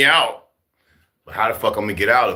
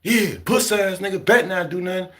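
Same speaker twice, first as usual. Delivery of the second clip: cocky taunt; 8 semitones higher than usual. Retold or imitated. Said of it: "I'm going".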